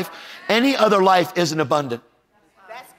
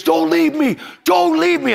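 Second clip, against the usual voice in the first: high, loud begging cry; retold panicked plea, pitched way up; desperate plea; 4 dB louder, slower than usual. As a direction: imitate an injured man pleading for company. Injured man pleading, retold.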